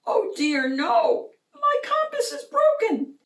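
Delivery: put-on very high, fluttery old-lady voice; a shrill, frantic high-pitched teacher crying for help; flustered teacher cry. A narrator's reading. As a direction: squeal it out like a panicked schoolmarm. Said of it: "she cried out".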